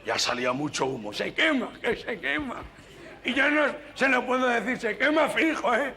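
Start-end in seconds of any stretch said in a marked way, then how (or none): 1.1-5.9 much higher, laughing nasal-voiced fretting; nasal stammerer. Nasal passenger can't warn the driver, hammed-up nasal fretting. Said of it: "compañero gangoso".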